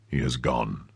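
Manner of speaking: low, soft man's report; grave Athos. A narrator's reading. Athos returning from the door, low and grave.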